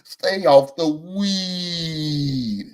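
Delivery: very slow, drawn-out preachy warning; slow emphatic stay off the weed; Stephen A Smith impression. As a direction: draw it out like a pundit scolding. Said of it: "in the words of Stephen A Smith".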